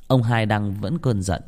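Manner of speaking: ordinary delivery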